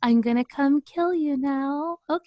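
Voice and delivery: lightly voiced creepy little girl; a hint of a sinister child, giggling. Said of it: "the creepy child voice".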